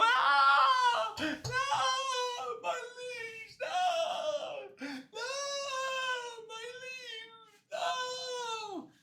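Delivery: high-pitched, drawn-out comic crying; mock anguished wail. Killer watching his leaves burn, high mock wail. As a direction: start screaming, then whimper in exaggerated grief.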